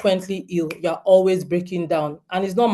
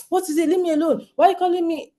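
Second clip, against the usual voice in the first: much higher, exasperated retort at her son; irritated snap; 9 semitones higher, faster than usual. Retold or imitated. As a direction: bite back at the kid, fed up. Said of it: "So I was so irritated".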